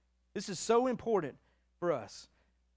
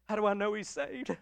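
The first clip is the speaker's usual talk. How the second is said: normal talking voice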